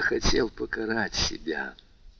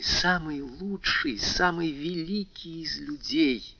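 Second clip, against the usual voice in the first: passionate confession; 6 semitones higher than usual. Acted. Haydée's rising confession of love.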